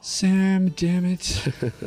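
normal talking voice

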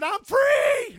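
very high, loud cry of release; high joyful yell; ecstatic shout. Retold or imitated. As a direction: shout it out like a freed man. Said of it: "He said".

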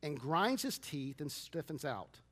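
measured, soft Bible reading voice; scripture reading. Acted. Reads Mark 9 scripture aloud.

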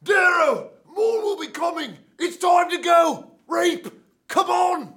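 high, very loud goblin shouting. Acted. Severo urging Darrow to go, high goblin-y shouts. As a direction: holler each phrase like a goblin rallying someone. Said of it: "Goblin-y, like you did the first time".

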